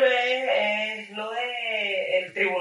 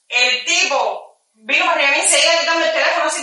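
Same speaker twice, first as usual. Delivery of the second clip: ordinary talk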